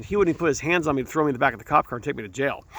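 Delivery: usual speaking voice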